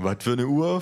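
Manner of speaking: a lightly voiced dopey thug; dim big thug. A narrator's reading. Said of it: "fragt der Große". (leicht gespielter dümmlicher Schläger)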